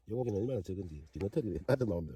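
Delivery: normal talking voice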